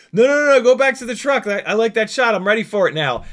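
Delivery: high, loud rush of protest easing off; urgent director. Retold or imitated. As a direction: imitate a director urgently calling someone back. Director urgently calling pilot back.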